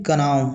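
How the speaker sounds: "कनाव" with the silent K sounded as "ka"; K pronounced. Shown as a demonstration. Teacher showing learners the awkward reading, "कनाव" with K sounded. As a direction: voice the K before N in "कनाव". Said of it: "कनाव पढ़ेंगे तो दिक्कत हो रहा है".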